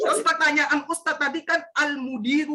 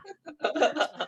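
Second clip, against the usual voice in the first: embarrassed laugh; 5 semitones higher, 3 dB quieter than usual. Real, not acted. Student laughs after his mistake.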